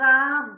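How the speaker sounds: राम loud with a long held आ; stretched ram. Shown as a demonstration. Teacher stressing the long vowel in Ram, loud and drawn out. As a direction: hold the आ of राम. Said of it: "कभी-कभी वैसे उच्चारण होता है ना".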